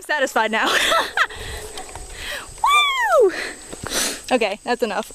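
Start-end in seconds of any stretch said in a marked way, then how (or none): 0.7-2.6 high breathless laugh. Real, not acted. After the field run. 2.6-3.3 high whoop. Real, not acted. Celebrating the field run, very high whoop.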